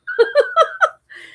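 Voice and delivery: high, loud giggle; laughing. Real, not acted. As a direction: burst into real, high-pitched laughter. Amused telling accent story.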